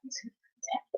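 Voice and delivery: breathy whispered ASMR-creator parody, very soft; mock the soft-spoken ASMR voice. Mocking ASMR creators to her viewers, whispering. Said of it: "they be like".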